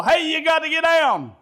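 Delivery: high, loud ballfield yell; re-enacted coach's shout; coach's holler. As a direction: holler it out to a player on the field. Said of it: "I could holler at my son".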